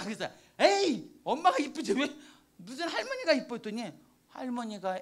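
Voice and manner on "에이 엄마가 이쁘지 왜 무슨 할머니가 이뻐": loud, playful brush-off; teasing protest. Retold or imitated. Grandma teasingly waves off her granddaughter's praise. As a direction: wave off the compliment laughingly.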